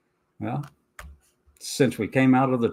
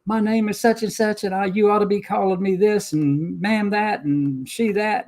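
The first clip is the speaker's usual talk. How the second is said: mocking demanding customer; 8 semitones higher than usual. Mimicking a customer at the Walmart counter, mock demanding. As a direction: parody an entitled customer lecturing the clerk. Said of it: "reach across the counter at Walmart and say".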